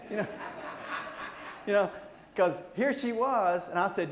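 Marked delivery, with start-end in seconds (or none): 0.3-1.6 soft, breathy chuckle; quiet laugh. Real, not acted. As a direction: laugh under your breath. Laughs at his own sweetheart line.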